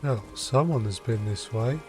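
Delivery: ordinary delivery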